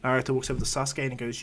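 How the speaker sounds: plain reading voice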